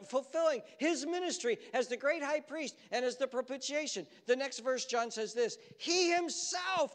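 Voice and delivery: high, loud preaching that keeps driving forward; preaching at full force. Acted. Pastor to his congregation, preaching at full force.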